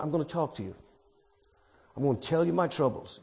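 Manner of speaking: very soft and low, a near-whispered plea; hushed prayer. Acted. Preacher voicing David's quiet prayer, hushed and low. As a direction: pray it quietly like a private confession.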